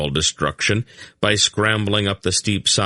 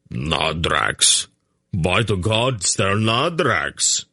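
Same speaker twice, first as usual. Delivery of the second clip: slow amazed cry; astonished shout; much slower than usual. A narrator's reading. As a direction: shout the discovery across the ravine. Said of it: "Beric shout".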